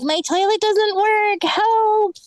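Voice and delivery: high, drawn-out mock plea; slow, high-pitched helpless complaint; helpless tenant. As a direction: imitate a tenant wailing for help. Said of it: "They're like".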